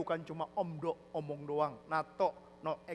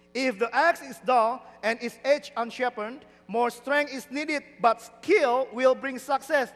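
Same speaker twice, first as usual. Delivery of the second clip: loud, slow English verse reading; declaimed scripture; 6 semitones higher, 10 dB louder, much slower than usual. Real, not acted. Preacher reading verse in English, slow declaiming. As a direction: proclaim each phrase slowly and loudly.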